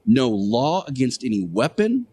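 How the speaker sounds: ordinary talk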